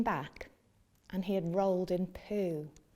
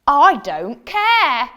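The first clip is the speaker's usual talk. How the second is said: slow, loud, flat refusal; bossy cat; 5 semitones higher, 15 dB louder, much slower than usual. Acted. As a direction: drag it out, not budging. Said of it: "said the cat".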